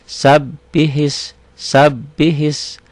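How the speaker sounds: very slow Sabbihis, bb held long; doubled b. Shown as a demonstration. Teacher drills the shadda for students, slow. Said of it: "tasdid itu pendouble".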